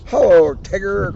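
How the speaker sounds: loud greeting called down from the sky; calling out as Owl. Acted. Owl calling down to Tigger from the air, loud. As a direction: hail him from high overhead. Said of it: "cried Owl".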